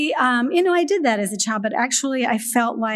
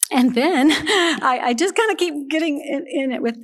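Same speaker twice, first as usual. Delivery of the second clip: fighting back tears as she admits it; near tears; 4 semitones higher than usual. Real, not acted. Mother confessing about her son, near tears. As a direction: voice catching with real tears, laughing it off.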